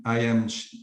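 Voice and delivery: ordinary delivery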